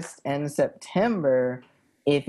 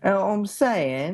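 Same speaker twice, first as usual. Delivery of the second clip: higher-pitched mock British accent; mock British rocker; 4 semitones higher than usual. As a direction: parrot it like a British rock star. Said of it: "We sound like the Rolling Stones".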